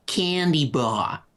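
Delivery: drawn-out mock-American words; attempted American accent. Asking a guest's favorite candy bar, attempted American accent. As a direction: put on an exaggerated American accent, stretching it. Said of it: "a pretty good attempt at an American accent".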